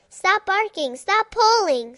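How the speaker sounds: high, loud call to a dog; scolding dog. Acted. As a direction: yell at the dog to stop. Owner scolding runaway dog.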